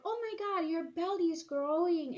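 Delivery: high, loud teasing squeal of a classmate; mocking shriek. Classmate mocking my belly in the lunchroom, loud high shriek. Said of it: "this girl was like".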